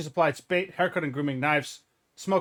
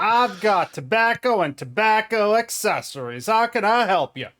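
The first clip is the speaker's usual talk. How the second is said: Southerner voice; 7 semitones higher, 5 dB louder than usual. Acted. Shopkeeper greets the players in a Southern accent. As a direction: put on a broad Southern twang to sell. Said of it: "I'll just do my Southerners voice".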